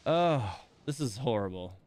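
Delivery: ordinary talk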